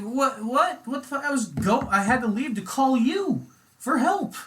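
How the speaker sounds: high, loud furious yelling; dad yelling at the lady cop over the ticket, high and loud; freaking out. Retold or imitated. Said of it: "my dad kinda fucking freaked out on her".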